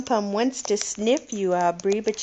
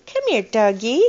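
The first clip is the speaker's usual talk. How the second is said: put-on louder sing-song model phrase for a toddler; reading to a toddler; 6 dB louder than usual. Shown as a demonstration. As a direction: call it out for the child to copy.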